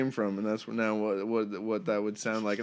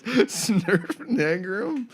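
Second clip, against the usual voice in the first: backwards speech; 7 semitones higher, much slower than usual. Shown as a demonstration. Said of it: "Say that".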